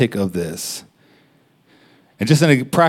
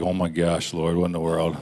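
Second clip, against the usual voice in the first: hushed shock; 5 semitones lower, 7 dB quieter than usual. Retold or imitated. Shocked inner prayer at vision.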